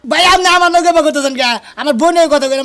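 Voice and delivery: high, loud outburst; angry protest. Acted. Objecting to the creditor talking to him, angry shout.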